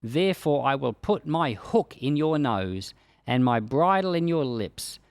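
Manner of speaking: usual speaking voice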